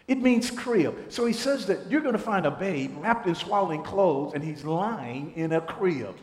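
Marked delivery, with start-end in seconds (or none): none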